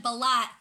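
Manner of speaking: "balat" punched, with an open a at the end; imitated pronunciation. Shout-out mimicking how he said it, punched "balat". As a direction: say balut as "balat", loudly. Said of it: "who said balat".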